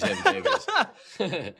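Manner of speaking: Babies having babies in a high, slow wail; high and slow with a laugh through it; high mock wail. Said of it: "that's what I'm saying".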